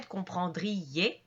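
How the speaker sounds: comprendriez slow, with a clear break between ri and ez; syllables separated. Shown as a demonstration. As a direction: pronounce comprendriez as compren-dri-ez, i and ez apart. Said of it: "ça va être la même chose pour I, E, Z".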